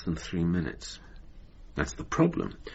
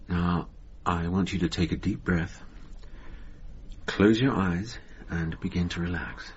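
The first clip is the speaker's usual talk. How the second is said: calm hypnotic guidance. Acted. Therapist easing an anxious patient into hypnosis, soothing. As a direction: guide her gently into relaxation. Therapist starts relaxation induction.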